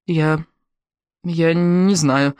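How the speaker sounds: halting, drawn-out, unsure reply; trembling voice. A narrator's reading. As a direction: stretch the words out hesitantly, as if trembling. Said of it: "дрожащим голосом произнесла Эмили".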